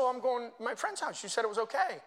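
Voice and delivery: high-pitched imitation of a girl; daughter answering dad. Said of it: "And she said".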